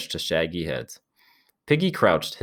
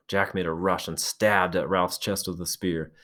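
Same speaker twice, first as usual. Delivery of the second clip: ordinary delivery, nothing marked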